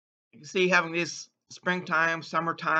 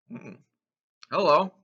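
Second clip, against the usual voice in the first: ordinary talk, nothing marked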